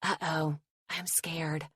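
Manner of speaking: soft, hushed mock fear; amused whisper. A narrator's reading. Woman jokes quietly to Logan, amused soft mock fear. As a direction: whisper it, teasing. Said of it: "I whisper with amusement".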